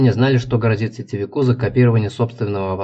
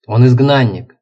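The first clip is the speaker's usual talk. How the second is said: louder, arguing back; 7 dB louder, slower than usual. Acted. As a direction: push back hard in the argument. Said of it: "заспорила Хоппер".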